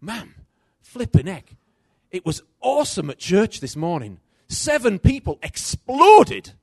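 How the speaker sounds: building to a high, loud, slow exclaim; hammed-up thrilled report from church; mock excited news. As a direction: son playing up his excitement to mum. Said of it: "I could say to her".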